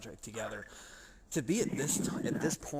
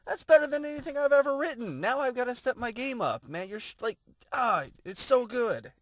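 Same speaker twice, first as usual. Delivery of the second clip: much higher and louder, gushing; excited praise; 13 semitones higher, 8 dB louder than usual. Retold or imitated. Praising a writer's review, loud and high. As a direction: rave like the review floored you. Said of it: "I'm like".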